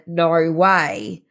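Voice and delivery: put-on "Norway" in an Australian accent, ending like "why"; Aussie "Nor-why". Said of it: "saying in an Australian accent".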